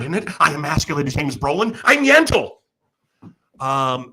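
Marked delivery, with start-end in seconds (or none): 1.8-2.5 high, loud proclamation like a Broadway star; hammed-up diva. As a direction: belt it out as the diva of Yentl. Grand diva declaration.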